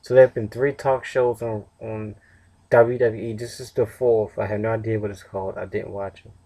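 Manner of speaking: usual speaking voice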